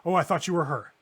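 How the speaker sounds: loud, high burst of alarm, easing into relief; acted startled shout that settles; startled outburst. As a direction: cry out in alarm, then back down. Startled gunman recognizes the players.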